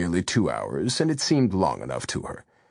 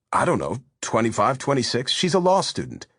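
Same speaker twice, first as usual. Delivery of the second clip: a hint of a breezy boyfriend, higher; lightly voiced Javier; 7 semitones higher than usual. A narrator's reading. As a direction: brush it off, amused. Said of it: "he said easily".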